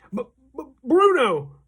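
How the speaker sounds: high, stuttering gasp of disbelief; shocked stammer. A narrator's reading. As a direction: choke on the name in shock. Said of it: "stammered Mister Jenkins".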